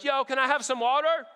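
banana guy. Retold or imitated.

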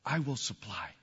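soft, low, unhurried divine voice; hushed and low, as God addressing one man. Acted. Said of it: "And God speaks to him".

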